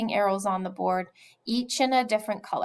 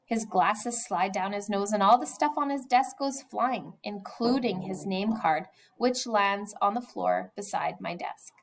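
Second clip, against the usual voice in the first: ordinary delivery, nothing marked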